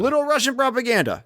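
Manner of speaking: high, fast emphatic burst; excited. Real, not acted. Excited agreeing outburst.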